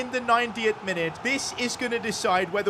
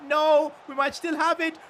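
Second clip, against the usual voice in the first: tense cry; 8 semitones higher, 4 dB louder than usual. Real, not acted. Chance slipping away in match.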